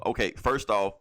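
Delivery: usual speaking voice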